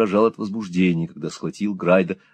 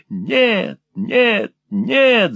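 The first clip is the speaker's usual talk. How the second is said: much higher shrieking no; frantic shriek; 11 semitones higher, much slower than usual. A narrator's reading. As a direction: shriek like a madman. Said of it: "завизжал тот".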